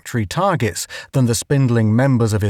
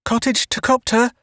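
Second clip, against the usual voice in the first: gasping; 11 semitones higher than usual. A narrator's reading. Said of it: "gasping for breath".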